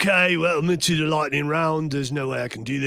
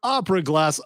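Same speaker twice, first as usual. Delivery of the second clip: mock infomercial; 2 semitones higher, 4 dB louder than usual. Host riffs a fake Acme ad, booming pitchman voice. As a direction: sell it like a booming TV ad announcer. Improvised ad continues.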